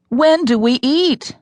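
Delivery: whining. A narrator's reading. Starving woman whines to the coach about food, slow and high. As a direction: drag out a hungry whine.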